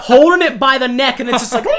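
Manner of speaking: high squealing laughter; laughing. Real, not acted. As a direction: laugh hard through it. Laughing about the live duck.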